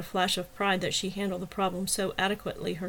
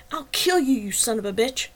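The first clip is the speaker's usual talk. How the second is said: angry; 3 semitones higher, 4 dB louder than usual. A narrator's reading. Attacker threatening in a fight.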